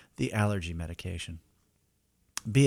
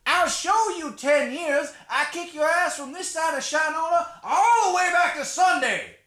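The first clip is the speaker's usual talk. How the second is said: high, strained braggart cook; angry chef voice. Acted. Chef boasts and threatens.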